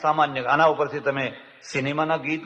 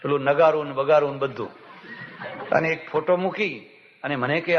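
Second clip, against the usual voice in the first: usual speaking voice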